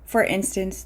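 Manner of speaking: ordinary talk, nothing marked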